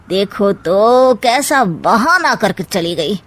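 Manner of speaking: ordinary talk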